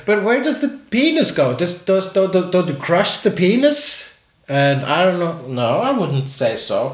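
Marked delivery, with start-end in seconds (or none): 0.1-4.1 higher, puzzled questioning voice; a bewildered friend asking; quoting a curious friend. Retold or imitated. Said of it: "she said".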